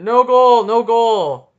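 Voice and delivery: loud mock ref call with a big voice; big manly ref voice. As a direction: puff up like a macho referee. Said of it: "with your big voice and your big manly".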